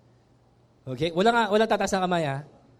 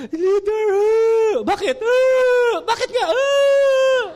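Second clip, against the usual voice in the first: hammed-up high loud wailing at the leader; mock wailing; 12 semitones higher, 7 dB louder, much slower than usual. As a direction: wail loudly like a whining member. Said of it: "iyakan ko lang yan". (kunwaring pag-iyak nang malakas at mataas)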